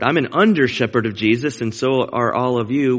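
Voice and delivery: usual speaking voice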